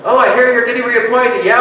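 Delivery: usual speaking voice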